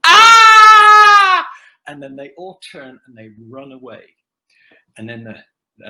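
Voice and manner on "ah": high, loud shriek of fright; imitated scream. Fans in the elevator doorway screaming at the sight of him. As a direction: scream as if you just saw a monster. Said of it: "took one look at me and go".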